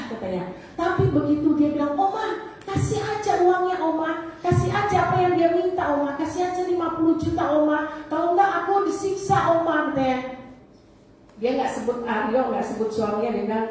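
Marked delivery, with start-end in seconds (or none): 1.9-9.9 put-on higher, pleading young girl voice; imitating pleading granddaughter. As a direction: beg Oma like a frightened granddaughter. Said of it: "suaranya persis dengan Tresia".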